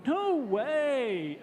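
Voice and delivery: high loud No way with rise-fall; No way rising then falling. Shown as a demonstration. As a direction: model the surprised tune on No way. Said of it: "they repeat the sentence".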